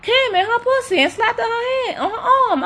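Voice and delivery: high, giggling retelling; high-pitched giggly telling; laughing. Real, not acted. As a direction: narrate through real laughter.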